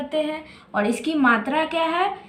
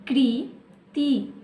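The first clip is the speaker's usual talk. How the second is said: Kriti spelt out slowly, part by part; slowed to syllables; much slower than usual. Shown as a demonstration. Teacher sounding out a word for young pupils, slowly. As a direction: break Kriti into slow pieces. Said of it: "कैसे पढ़ेंगे इसे?".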